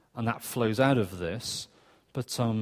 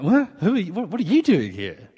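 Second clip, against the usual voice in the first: surprised; 5 semitones higher, 4 dB louder, faster than usual. Retold or imitated. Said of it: "And I'm like".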